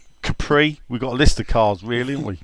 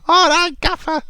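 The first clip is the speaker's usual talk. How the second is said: much higher greeting voice; impression of Kev. Player greeting his manager, high put-on voice.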